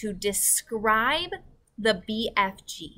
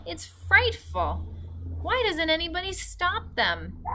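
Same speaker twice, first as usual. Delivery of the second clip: crying out; 11 semitones higher than usual. Acted. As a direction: cry it out in horror. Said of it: "Sophie cried".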